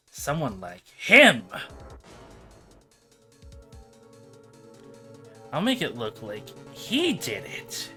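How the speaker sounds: scheming villain. Acted.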